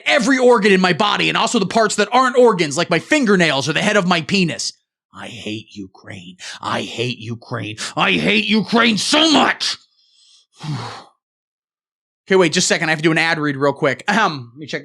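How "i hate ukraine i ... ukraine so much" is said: mock seething hatred. Slow, low, seething hatred chant.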